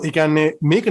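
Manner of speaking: ordinary talk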